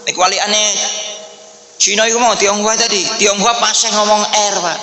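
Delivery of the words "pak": usual speaking voice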